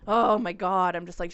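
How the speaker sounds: ordinary talk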